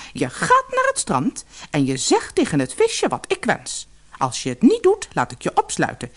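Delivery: high, threatening orders; commanding queen. Acted. Queen threatens to lock up her husband, high and commanding. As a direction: bark orders like a tyrant queen.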